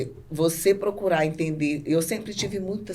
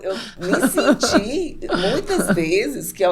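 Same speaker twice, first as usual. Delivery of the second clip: slow, high words with laughter; laughing while talking; 5 semitones higher, 6 dB louder, slower than usual. Real, not acted. Guest still laughing after the joke, laughing speech. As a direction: talk through a laugh.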